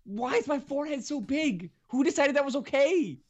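very high, strained comic outrage; exasperated wail. Real, not acted. Podcaster ranting about his face in photo, exasperated wail. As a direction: squeal each complaint in mock despair.

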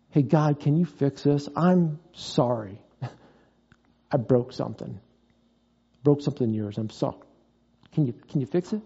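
soft, low penitent plea to God; humble confession. Acted. Acting out a penitent asking God.

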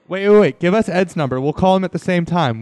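high, loud rush of words; excited urging. Real, not acted. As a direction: jump in before they hang up. Urgent interruption, excited.

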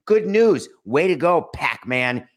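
loud, high mock praise; sarcastic cheer. Real, not acted.

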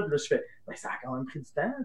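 usual speaking voice

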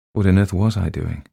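ordinary delivery, nothing marked

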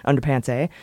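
ordinary delivery

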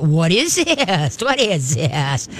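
slow, lilting, playful coax; laughing voice. Shown as a demonstration. Trainer coaxing the dog up to pants on a chair, laughing voice. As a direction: draw it out like a teasing invitation. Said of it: "in a laughing voice go".

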